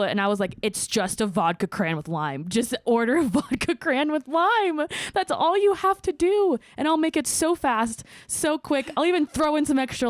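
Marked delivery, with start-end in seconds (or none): 2.5-10.1 exasperated plea. Retold or imitated. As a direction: beg them to just order it. Pleading with customers about nicknames.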